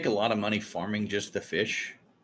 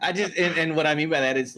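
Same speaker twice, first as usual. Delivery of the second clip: higher and louder, still laughing; 8 semitones higher, 8 dB louder than usual. Real, not acted. Leader explaining to Pandra while laughing, laughing. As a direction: push through the giggles to explain.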